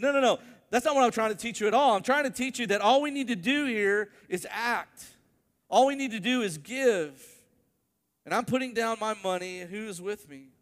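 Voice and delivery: loud, high, fast insistence as Tony; rally the room to give now; pushing the crowd to act. Retold or imitated. Tony corrects lady, rallies room.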